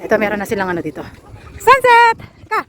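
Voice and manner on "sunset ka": calling out. Real, not acted. Calling out to dog.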